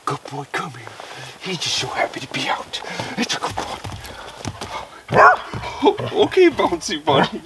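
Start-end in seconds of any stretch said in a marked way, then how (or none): 3.8-6.1 high, loud burst of laughter. Real, not acted. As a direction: laugh at the bouncy dog. Laughing at the dog.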